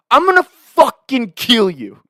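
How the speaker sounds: high, loud, slow mock rage; imitated angry threat. Golfer marching at the friend who ruined his shot, angry. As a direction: stretch out a yelled threat.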